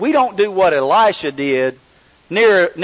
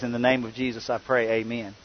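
softer closing of the prayer; soft amen; 5 semitones lower, 10 dB quieter than usual. Retold or imitated. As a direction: let the prayer fade out. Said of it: "of course he says".

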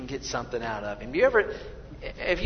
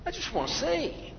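higher, small, longing voice; longing plea; 9 semitones higher, 6 dB quieter than usual. Retold or imitated. Bartimaeus telling Jesus his wish, higher and longing. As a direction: ask quietly like a blind man aching to see. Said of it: "a certain amount of".

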